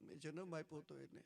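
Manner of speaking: soft, hurried grieving plea, understated; grieving mother's plea to God, softly hurried; grieving reproach. A narrator's reading. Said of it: "એટલી પોક મૂકીને રડી".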